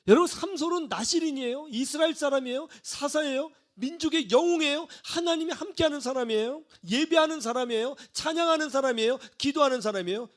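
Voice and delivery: high-pitched, insistent preaching list; emphatic preaching. Real, not acted. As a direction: drive each statement home to the congregation.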